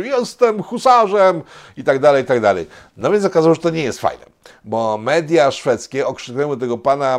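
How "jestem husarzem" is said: high, loud mock proclamation; hammed-up warrior's boast; boasting Polish hussar. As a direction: declare it like a proud hussar. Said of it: "to jakby Polak wyskoczył".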